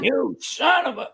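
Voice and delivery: slow, high mock curse; retold swearing. Cook cursing the camera remote, retold exasperated swearing. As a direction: drag out an exasperated, cut-off curse. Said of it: "you should hear the language".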